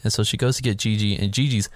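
normal talking voice

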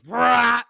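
loud, high "rah" of a ship roaring by; imitated speeding ship. Storyteller showing the cutter racing past them, loud whoosh noise. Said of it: "whole ass ship just goes".

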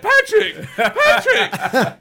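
high, strained calling through a wrecked voice; imitating your own drunken, voiceless gushing; lost-voice impression. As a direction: call out to your hero with hardly any voice left. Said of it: "I was talking like this all night".

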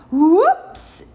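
high, loud cry of slipping; sudden storybook whoops; startled whoops. A narrator's reading. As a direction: yelp it as if losing your footing. Sudden slip on the seaweed.

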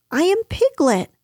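high, emphatic little voice; insistent Piglet. A narrator's reading. Piglet insists on his name to Kanga, emphatic. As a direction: declare it firmly, pitched high. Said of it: "said Piglet loudly".

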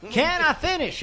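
mock Texas accent. Host does a Ross Perot Texas accent for friends. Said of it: "that's a Texas accent that you're doing".

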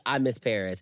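ordinary talk

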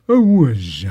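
a silly buzzed whiz in a put-on voice. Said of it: "[name] opening a door".

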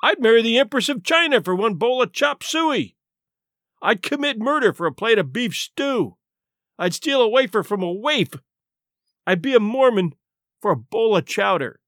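acted hungry captain's tirade; defiant outburst. A narrator's reading.

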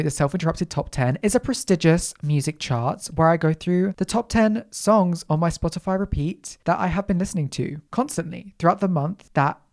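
ordinary delivery

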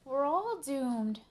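high, loud, drawn-out wail; melodramatic doom. Acted. D W despairing over the broken computer, melodramatic. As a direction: proclaim disaster with great drama. Child predicts family disaster.

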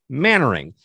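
"Mannering" said as MAN-er-ing, not Main Wearing; name pronunciation. Shown as a demonstration. Host correcting the guest's name for listeners, "Mannering" as MAN-ering. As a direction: say "Mannering" with a short first syllable, MAN-ering. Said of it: "is spelled like Main Wearing".

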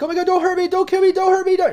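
high, loud panicked pleading; terrified screaming. Acted. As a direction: scream for your life. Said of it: "she just starts screaming".

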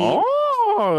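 long, very high-pitched "oh"; delighted surprise. Real, not acted. Co-host learning the guest lived in Nashville, delighted surprise. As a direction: draw it out high with real surprise.

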